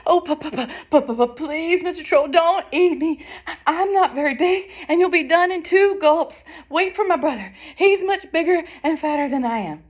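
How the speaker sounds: high, stuttering plea; pleading, frightened goat. Acted. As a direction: beg for your life in a high panicky stammer. Terrified goat pleads with troll.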